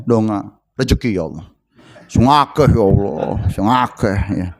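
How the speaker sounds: mocking a casual, low-voiced prayer; imitated offhand praying, low and unpleading; arrogant prayer. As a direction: toss off the requests low, dropping away on God's name. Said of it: "donga kita sombong".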